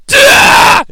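high, loud mock battle cry; sudden imitated maniac scream; leap-off-the-ropes holler, hammed up; madman yell. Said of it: "He's just like".

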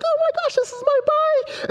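very high squealed imitation of a daughter; hammed-up falsetto kid excitement; overjoyed little girl. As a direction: squeal it like a thrilled child. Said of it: "She was so happy".

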